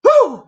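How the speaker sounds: real, loud, high exhale after a rant; loud whew. Real, not acted. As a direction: blow it out after getting worked up. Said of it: "I'm getting fired up here".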